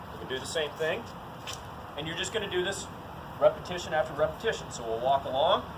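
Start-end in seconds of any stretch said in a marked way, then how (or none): none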